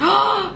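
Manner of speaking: sharp gasp of shock; surprised gasp. Real, not acted. Host gasping at the father's death, shocked. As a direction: gasp at the news. Reacting to James dying.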